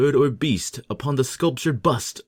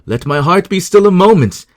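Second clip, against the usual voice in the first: louder, higher, breathless with nerves; agitated plea; 7 semitones higher, 8 dB louder than usual. A narrator's reading. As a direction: try to steady yourself but fail. Narrator calming his racing heart.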